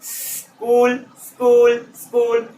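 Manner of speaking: "स्कूल" from a long hiss to a quick s; s getting shorter. Shown as a demonstration. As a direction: repeat "स्कूल", shrinking the opening s. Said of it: "time को कम कर दो". ("स्कूल" में स घटाते हुए)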